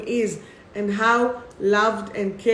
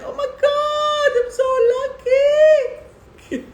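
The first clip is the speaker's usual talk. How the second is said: very high, drawn-out squeal of delight; gushing squeal; 6 dB louder, slower than usual. Acted. Card reader thrilled at being loved more than chocolate, gushing squeal. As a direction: squeal it way up high, stretching the words out. Gushing over a viewer's compliment.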